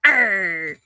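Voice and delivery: mock pirate grumble, tired; playful pirate snarl; grouchy pirate growl. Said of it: "and I'm like".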